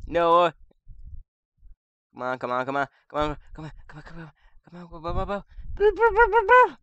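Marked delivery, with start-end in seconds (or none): 2.2-6.8 psyching myself up. Real, not acted. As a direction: urge yourself on, rising to a yell. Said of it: "psyching myself up".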